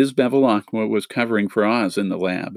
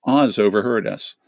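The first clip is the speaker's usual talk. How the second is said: ordinary delivery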